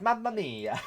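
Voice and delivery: mock Italian cry of mamma mia; Italian accent. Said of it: "You want me to be like".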